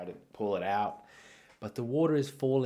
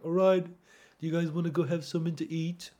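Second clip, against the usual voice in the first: higher, louder dad voice; imitated dad; 9 semitones higher, 5 dB louder than usual. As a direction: play a dad rounding up the family. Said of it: "my dad was like".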